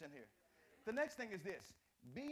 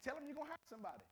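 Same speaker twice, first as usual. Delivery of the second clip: imitating mama; 9 semitones higher, much faster than usual. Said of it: "And I think mama said".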